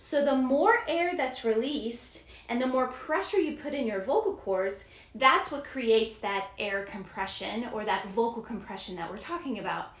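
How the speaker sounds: ordinary talk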